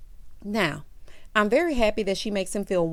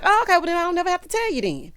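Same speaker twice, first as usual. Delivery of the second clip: sassy; 10 semitones higher, 5 dB louder, faster than usual. Retold or imitated. Voicing Alexis flippantly.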